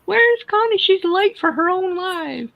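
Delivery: squeaky, high and loud; high mock worried voice. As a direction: pitch way up like a fretting fan. Voicing worried viewer.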